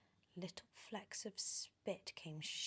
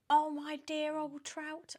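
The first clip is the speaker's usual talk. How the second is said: very high, slow, preening voice; high, silly show-off voice; vain Aunt Sponge; 9 semitones higher, 10 dB louder than usual. Acted. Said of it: "Aunt Sponge cried out".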